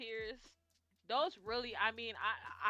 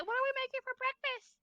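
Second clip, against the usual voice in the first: put-on much higher, bright cheery kid voice; little girl voice; 9 dB louder than usual. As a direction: chirp it like a child who forgot she cried. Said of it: "she said".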